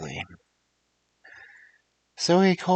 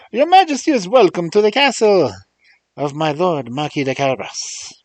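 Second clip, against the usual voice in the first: put-on much higher, louder Spanish-accented cat; 8 semitones higher, 9 dB louder than usual. A narrator's reading. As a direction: Puss announcing with a Spanish flair. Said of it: "I had to do the accent for Puss".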